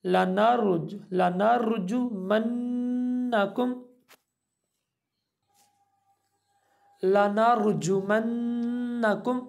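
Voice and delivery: drawn-out Quranic recitation; slow chanted tajweed. Shown as a demonstration. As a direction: recite it slowly, letting the vowels ring. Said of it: "काफ मीम पेश कुम".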